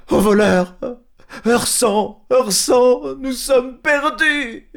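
high, slow wailing alarm; panicked cry for help. Acted. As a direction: wail for help, robbed and desperate. Isengrin discovers the theft. (appel au secours affolé, aigu et lent)

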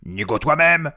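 loud, high comeback; angry troll retort. Acted. Tom returning Léon's insult, loud anger. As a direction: hurl the insult straight back. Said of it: "trois trolls en colère".

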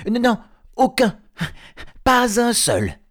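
very high, loud denial; panicked hobbit backtracking. Acted. Bilbo trying not to betray his friends, high panicked blurt. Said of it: "ajouta-t-il du même souffle".

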